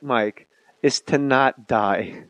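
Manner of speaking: slow, each word weighted; slow emphatic advice. Acted. Advising his guest on life, slow and emphatic. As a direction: drag it out to land the joke. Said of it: "the trick".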